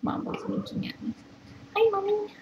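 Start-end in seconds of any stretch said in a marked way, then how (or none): 1.8-2.3 high sing-song hello. Real, not acted. Greeting the mother animal in baby talk. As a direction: coo up high at her.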